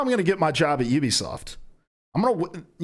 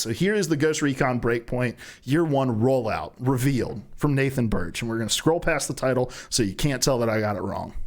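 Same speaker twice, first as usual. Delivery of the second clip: normal talking voice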